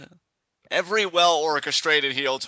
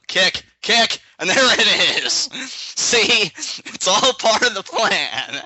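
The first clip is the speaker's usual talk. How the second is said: louder, higher, crowing as it unfolds; excited play-calling; 7 semitones higher, 6 dB louder, slower than usual. Real, not acted. Watcher calling the predicted moves as they happen, excited. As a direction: call each hit with triumphant excitement.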